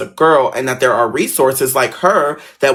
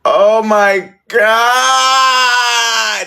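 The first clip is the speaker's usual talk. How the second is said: high, long drawn-out wail; wailed dismay; 9 semitones higher, 4 dB louder, much slower than usual. Retold or imitated. Student reacting as the boy cries, wailed dismay. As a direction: cry it out, stretching "God" right out.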